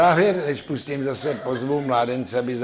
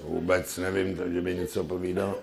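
sheepish patient; 5 semitones lower, 7 dB quieter than usual. Acted. Patient answers doctor.

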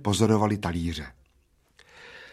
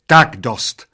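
loud, sharp yell to stop; screech; 2 semitones higher, 10 dB louder than usual. A narrator's reading. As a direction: cut them off with a shout. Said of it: "zaječela číšnice". (zaječí nahlas)

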